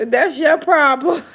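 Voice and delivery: cracking up mid-sentence; giggly teasing laugh. Real, not acted. Laughing at friend's remark.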